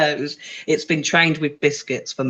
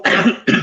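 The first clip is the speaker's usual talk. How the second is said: real choking cough; 3 semitones higher, 4 dB louder than usual. Real, not acted. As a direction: cough hard mid-sentence. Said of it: "Just choking".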